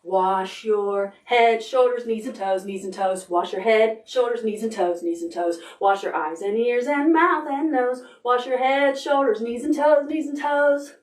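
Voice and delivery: rushed rattled-off song; turbo fast. Pretend. Parent singing turbo fast for the child, rushed. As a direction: race through it breathlessly. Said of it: "Do you think you could do it turbo fast?".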